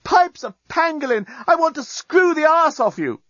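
high, excited shouting; crying out. A narrator's reading. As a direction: holler it down to the next cell. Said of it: "cried the journalist".

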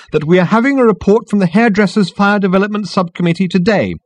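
high-pitched, strident firmness; firm. A narrator's reading. Girl continues to the committee, firmly. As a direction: insist on the agenda. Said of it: "continued the girl firmly".

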